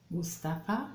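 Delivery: ordinary talk, nothing marked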